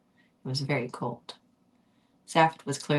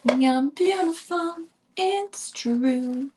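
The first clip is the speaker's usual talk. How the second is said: much higher, louder slow falsetto; silly high voice; 10 semitones higher, 4 dB louder, much slower than usual. Pretend. Reader joking with listeners, loud high voice.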